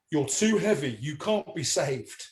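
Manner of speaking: slow, falling pronouncement; solemn verdict. Retold or imitated. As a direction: deliver it slowly and gravely, as a retold sleep-talker. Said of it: "I said".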